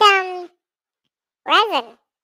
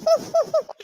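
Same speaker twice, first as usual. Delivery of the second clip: high squeaky imitation laugh; mock Kendra's giggle up high; imitating a friend's laugh; 11 semitones higher than usual. Imitating Kendra's laugh, high giggle. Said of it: "I could totally imitate her".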